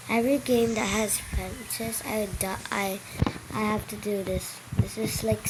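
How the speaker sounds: ordinary talk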